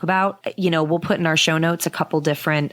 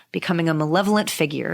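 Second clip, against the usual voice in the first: ordinary talk, nothing marked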